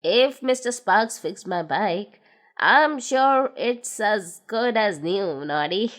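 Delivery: put-on low, older gnome voice; a low, fond old friend; Big Ears thanking Noddy. A narrator's reading.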